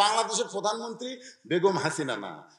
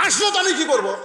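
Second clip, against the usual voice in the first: wife's snappy retort; 10 semitones higher, 6 dB louder than usual. Retold or imitated. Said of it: "বউ ছিল".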